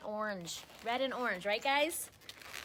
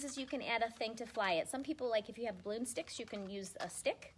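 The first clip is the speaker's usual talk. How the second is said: ordinary talk, nothing marked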